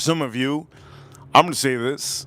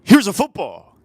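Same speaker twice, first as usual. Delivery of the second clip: loud quote; 6 semitones higher, 8 dB louder than usual. Retold or imitated. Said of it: "he goes".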